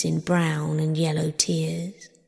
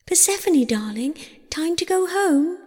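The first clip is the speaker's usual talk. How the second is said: high, lilting mother's call; 10 semitones higher than usual. A narrator's reading. Demeter calls her daughter home, high calling voice. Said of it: "she cried".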